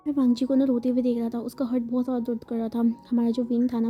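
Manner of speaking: plain reading voice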